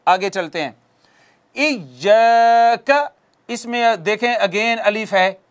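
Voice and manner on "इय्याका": इय्याका with the ा held one alif; long alif. Shown as a demonstration. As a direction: stretch इय्याका. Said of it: "एक अलिफ के बराबर हम पढ़ेंगे". (इय्याका, एक अलिफ खींचकर)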